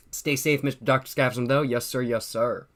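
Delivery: ordinary talk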